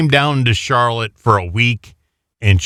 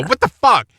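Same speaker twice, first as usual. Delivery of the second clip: mock angry outburst; 11 semitones higher than usual. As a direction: blurt it like you just found out. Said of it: "I would call her and go".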